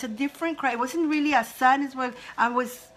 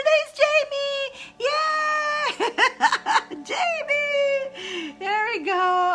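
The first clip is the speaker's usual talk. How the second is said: much higher, joyful cry of her name; excited shout; 3 dB louder, much slower than usual. Real, not acted. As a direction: cheer as you finally spot her. Spots the viewer she was calling.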